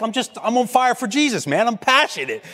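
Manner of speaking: high-pitched, impassioned self-defence at normal volume; revved-up protest. Retold or imitated. Preacher answering the remark that he sounds angry, revved-up protest. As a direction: act out your own fired-up retort, pitch jumping up. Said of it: "I get revved up when I preach".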